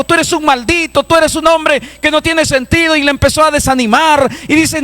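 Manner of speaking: high-pitched, driving sermon delivery; fervent preacher in full cry; full-force preaching. Acted. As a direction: preach it hard, pitched far above normal talk.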